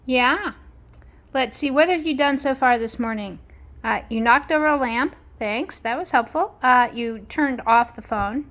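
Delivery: high, bright pet-talk to the dog. Acted. Owner chatting to her dog Spark, high and playful. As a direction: coo at the dog while checking on her.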